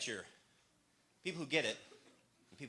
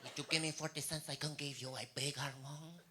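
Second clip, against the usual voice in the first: put-on softer, lightly accented cashier voice; Puerto Rican cashier; 10 dB quieter than usual. Cashier at McDonald's offering a bigger size, soft accent. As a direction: play the cashier politely offering an upsell. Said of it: "she goes".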